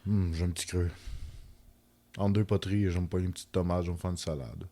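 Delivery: low, syrupy sweet voice; honeyed voice. Pretend. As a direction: coax them in a low honey voice. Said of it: "je vais prendre une voix mielleuse".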